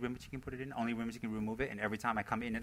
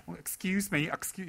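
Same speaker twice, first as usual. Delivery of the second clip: light, higher mock English accent, "Oh, excuse me"; a polite, higher imitation of his English accent; 7 semitones higher than usual. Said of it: "his wonderful English accent".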